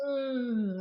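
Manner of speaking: long, drawn-out "mmm" of pleasure; hammed-up burger-commercial moan; orgasmic food moan. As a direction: savour the bite with mock ecstasy. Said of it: "like, orgasming over it".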